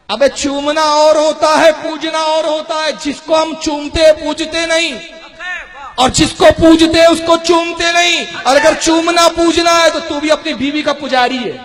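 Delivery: loud, high-pitched rebuttal; full-force preaching. Real, not acted. Preacher rebutting those who call kissing idolatry, heated preaching. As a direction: hammer out the argument to the crowd.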